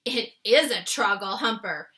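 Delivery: the BFG insists, a little fed up; exasperated giant. Acted. The BFG corrects Sophie, mildly exasperated. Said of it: "cried the exasperated B F G".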